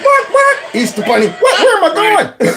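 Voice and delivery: high startled squeak; mock bewildered old man jumping at the call; mocking Joe surprised by the Easter bunny.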